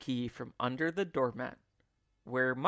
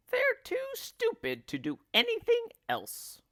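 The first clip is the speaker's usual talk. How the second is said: put-on much higher spiteful old woman voice; shrill Missus Twit. A narrator's reading. Missus Twit sneering at the monkeys in a high old-woman voice. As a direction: sneer it like a nasty old wife. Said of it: "said Missus Twit".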